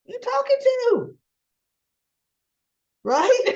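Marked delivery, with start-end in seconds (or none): 0.0-1.2 rear back in alarm; startled whoa. Retold or imitated. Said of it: "And she's like".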